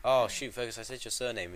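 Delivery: normal talking voice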